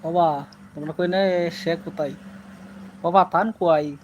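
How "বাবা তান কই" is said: Sylheti example phrases; Sylheti dialect. Shown as a demonstration. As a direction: demonstrate Sylheti speech to viewers. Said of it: "এটা হলো সিলেটি ভাষা". (সিলেটি টানে বলা)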